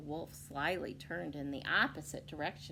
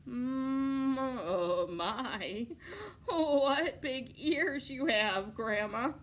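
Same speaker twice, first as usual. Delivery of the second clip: nervous; 6 semitones higher, 6 dB louder, much slower than usual. Acted. Scared wolf to grandma.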